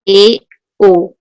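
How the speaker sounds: teacher modelling E and O; demo vowels E O. Shown as a demonstration. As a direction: model the mid vowels for the class. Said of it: "Pronunciemos E".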